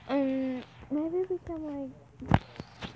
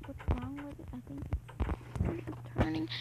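ordinary talk

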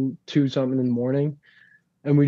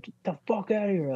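higher-pitched retold angry dismissal; aggressive; 7 semitones higher, 6 dB quieter than usual. Older brother shooing the kid from practice, mock aggressive. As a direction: imitate an aggressive brush-off. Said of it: "probably not so aggressively".

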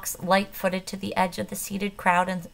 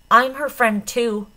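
urgent; 5 semitones higher, 8 dB louder, slower than usual. A narrator's reading. Pleading to the gunmen.

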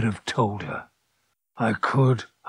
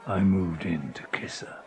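whisper; 3 semitones lower, 7 dB quieter than usual. Acted. Intimate confession narrated.

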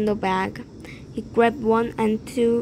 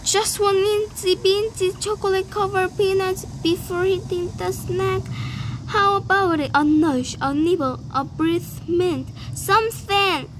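high, coaxing plea; much higher pleading tooth voice; wheedling tooth; 9 semitones higher than usual. A narrator's reading. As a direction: sweet-talk him into a snack.

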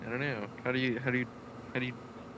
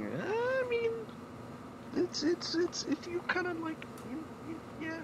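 laughing; slower than usual. Real, not acted. Laughing at a lucky roll.

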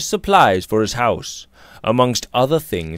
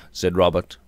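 ordinary delivery, nothing marked

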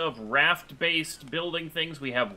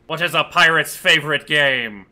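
pirate voice; 9 dB louder than usual. Acted. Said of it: "the pirate voice really hurts your throat".

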